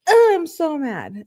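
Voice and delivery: high, loud and slow, hamming up her anger; mock fury. Imitating the girlfriend banging her head on the phone, hammed-up fury. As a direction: act out a furious girlfriend, half-joking. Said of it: "I think she was like".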